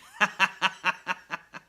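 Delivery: loud high evil cackle; evil laugh. Retold or imitated. Red Goblin gloating after his ultimatum, loud evil laugh. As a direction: gloat over the threat.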